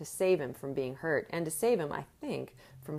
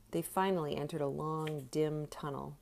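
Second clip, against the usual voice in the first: usual speaking voice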